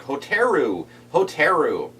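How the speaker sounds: "Hotaru" called out twice, Americanized as ho-TAIR-u; mispronounced name. Retold or imitated. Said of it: "couldn't pronounce Hotaru properly".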